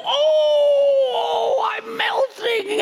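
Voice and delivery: high, loud, drawn-out melodramatic wail; hammed-up melting villain cry; mock dying wail. Father playing the defeated lie for daughter, mock agonized wail. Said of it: "And then I'd go".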